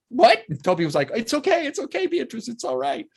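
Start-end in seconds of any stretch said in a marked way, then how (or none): 0.1-0.4 very high, loud mock astonishment; startled disbelief. Imitating Steven's reaction to the swearing, loud high surprise. 1.2-3.1 high-pitched soothing imitation; Toby reassuring. Imitating a director comforting an upset actress, high gentle reassurance.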